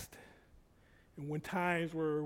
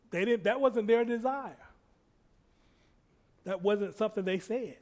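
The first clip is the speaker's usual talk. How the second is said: normal talking voice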